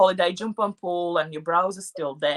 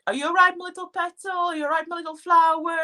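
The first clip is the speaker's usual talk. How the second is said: quick, high, sing-song endearment; sweet pet names; 8 semitones higher, faster than usual. Retold or imitated. Newcomer recalls how Latvians sweetly say it, quick high coo. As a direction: coo it fast like to a little child. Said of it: "we would probably say in Latvia".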